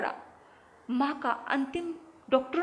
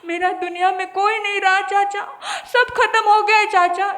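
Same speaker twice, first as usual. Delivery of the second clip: put-on 1 sobbing boy; 7 semitones higher, 10 dB louder than usual. Boy clings to uncle, crying.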